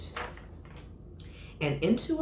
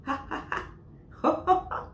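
hearty ha ha ho ho; 14 semitones higher than usual. Acted. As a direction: laugh it out, building. Elephant laughing out.

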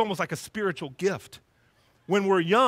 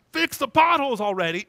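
exasperated driver's yell, loud and raised; yelling at potholes; 5 semitones higher, 7 dB louder than usual. Retold or imitated. Angry driver yelling at the road crew. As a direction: bark it like a fed-up driver. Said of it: "and we yell out".

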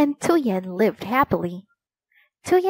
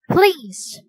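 pleading mother; 8 semitones higher, 3 dB louder than usual. Acted.

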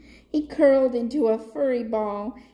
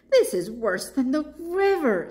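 panda's dismay; 6 semitones higher, 3 dB louder than usual. A narrator's reading. Papaya complains in flight.

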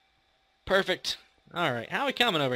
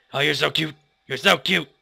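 cooing at pets; 8 dB louder than usual. Pretend. Cooing at animals in game.

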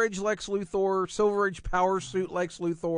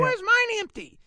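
put-on much higher, hurt little-boy voice; whiny kid Robin; 3 dB louder than usual. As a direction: play a wounded sidekick asking why. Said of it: "He goes".

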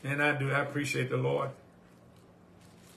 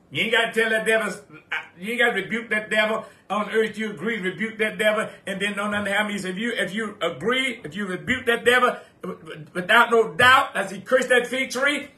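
louder, higher preaching build; preaching at full force; 5 semitones higher, 6 dB louder than usual. Acted. Pastor telling the congregation about faith, fiery preaching. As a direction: drive it home with rising fire.

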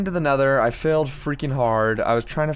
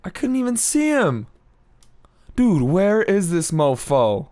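exasperated; 8 semitones higher than usual. Real, not acted. Gamer stuck on a ledge after being shot, exasperated.

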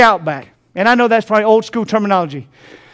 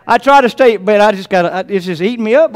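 ordinary talk

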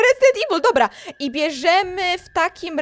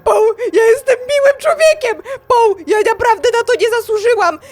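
high, loud tearful wail; panicked mock crying; 7 semitones higher, 6 dB louder than usual. Fleeing Paul, wailing in mock tearful panic. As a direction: sob in comic desperation.

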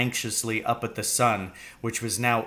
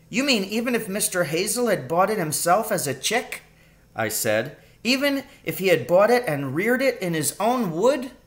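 put-on a young boy in disbelief, raised; higher astonished boy voice; 9 semitones higher than usual. A narrator's reading. Danny questions his father about the pheasants, in a higher astonished boy voice. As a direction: son can't believe it, voice lifted.

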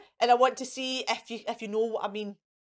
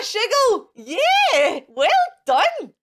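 laughing; 7 semitones higher, 6 dB louder, much slower than usual. Real, not acted. Laughing praise to children.

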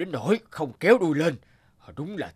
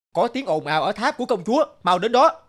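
alarmed shout; 6 semitones higher, 3 dB louder than usual. Acted. Guards alarm, giants flee.

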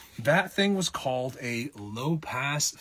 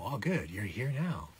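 normal talking voice

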